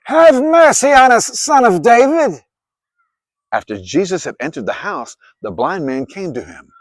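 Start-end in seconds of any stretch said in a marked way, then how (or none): 0.1-2.4 high, loud desperate plea; crying out. Acted. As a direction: shout for mercy from the roadside. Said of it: "crying out".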